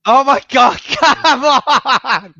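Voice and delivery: high, loud laughing protest; laughing outcry. Real, not acted. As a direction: cry out laughing in disbelief. Told to spin in chair.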